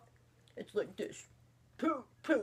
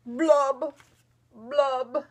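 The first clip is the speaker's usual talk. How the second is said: slow, loud, droopy fish voice with mopey blubs; sing-song glum fish, dragging every word; gloomy pout fish; 7 semitones higher, 5 dB louder, slower than usual. Acted. Mother reading to daughter as the glum fish. Fish's refrain third time.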